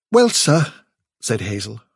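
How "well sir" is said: higher, deferential hesitation; rather hesitant. A narrator's reading. Young rabbit addressing the chief, rather hesitant. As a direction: begin tentatively to a superior. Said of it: "rather hesitantly".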